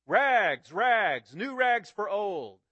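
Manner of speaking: loud, slow, ringing vendor's cry; a ragman calling out his trade at dawn; street call. Acted. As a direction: call it out clear across the street. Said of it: "calling in a clear tenor voice".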